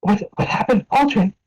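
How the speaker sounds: high, alarmed diner owner; a middle-aged woman rushing up in alarm; worried wife. Acted. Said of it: "she's like".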